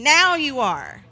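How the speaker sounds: loud, drawn-out punchline; emphatic punch. Shown as a demonstration. Preacher showing the congregation how emphasis helps, emphatic. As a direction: jolt them to attention.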